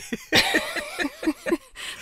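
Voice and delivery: high, bright burst of laughter. Real, not acted. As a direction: break into real laughter. Laughs at the joke about teeth.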